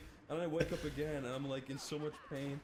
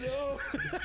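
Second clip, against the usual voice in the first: very high, loud "oh no"; mock dismay; 5 dB louder than usual. Seeing the drunken photos, high mock dismay. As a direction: squeal it in exaggerated dismay. Acts his reaction to photos.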